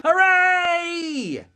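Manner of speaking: high, drawn-out hooray; long cheering call. Acted. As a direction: let out a big high cheer. Cheer after hip, hip.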